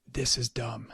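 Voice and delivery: breathy retold whisper; hushed aside to the teacher. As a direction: whisper it like a secret in class. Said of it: "he whispered to me".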